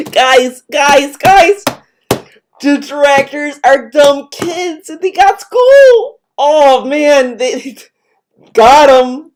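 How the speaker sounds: high, slow, hammed-up hilarity; sarcastic mock amazement. Listener mocks streamer's dumb-kids joke, sarcastic awe.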